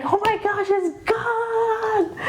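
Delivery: high, delighted exclamation; excited. Retold or imitated. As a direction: squeal it in relief. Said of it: "and I was like".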